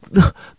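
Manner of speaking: brief burst of laughter mid-joke; short laugh. Real, not acted. Laughing while teasing Tifa, a short burst. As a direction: laugh through the gag.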